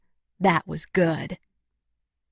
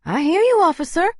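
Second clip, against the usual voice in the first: much higher, brisk woman demanding; Luna's voice; 11 semitones higher, 3 dB louder than usual. Acted. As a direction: take charge of the crowd. Luna's voice held.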